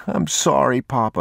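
put-on high, small boy's apology; little boy near tears, pitched high; about to cry. A narrator's reading. Boy who left the pistol behind, near-tears apology. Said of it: "he was about to cry".

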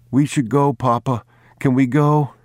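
put-on an anxious boy begging his father to go; boy pleading with Papa to leave the old house; pleading child. A narrator's reading. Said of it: "boy begs to leave the house".

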